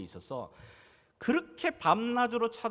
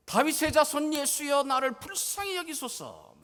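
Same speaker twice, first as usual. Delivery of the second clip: high, loud desperate cry to Jesus; 11 semitones higher, 6 dB louder than usual. Retold or imitated. Blind Bartimaeus crying out to Jesus for mercy, loud plea. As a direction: call out over the crowd, pleading. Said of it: "외칩니다".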